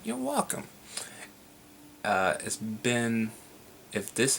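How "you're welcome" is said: soft, much higher little squeak; cute squeaky reply. Pretend. Replying to the fan's thanks in a soft squeaky voice. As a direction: coo it sweetly like a cartoon.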